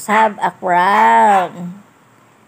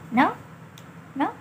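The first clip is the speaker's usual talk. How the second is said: ordinary talk, nothing marked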